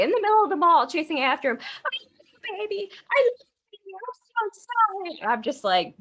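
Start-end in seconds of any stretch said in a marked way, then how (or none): 1.6-5.2 very high imitation of a pleading girlfriend; hammed-up squeaky begging; mock desperate pleading. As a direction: call after him in a high mock wail. Sally chases boyfriend through mall.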